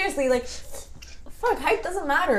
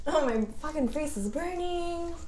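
mock flustered; 2 semitones higher, 3 dB quieter than usual. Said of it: "And you're like".

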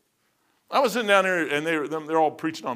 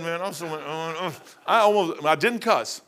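ordinary talk

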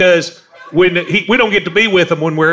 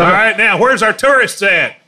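calling out; 6 semitones higher than usual. Real, not acted. Calling out to the crowd.

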